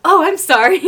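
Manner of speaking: high, loud flustered apology; exclaimed, high-pitched oops-sorry; gushing apology. Retold or imitated. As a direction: apologize as if suddenly remembering someone. Fan apologizes to neglected friend.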